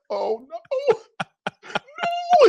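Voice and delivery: high, wailing oh no; mock panic. Rookie hearing his name called, high mock wail. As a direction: play the rookie's panic for laughs.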